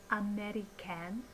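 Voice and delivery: "American" ending in a full "can"; over-enunciated "American" with a short-a in the last syllable; unreduced last syllable. Shown as a demonstration. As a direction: say "American" as a-meh-ree-CAN, no schwa. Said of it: "instead of a in the syllable can".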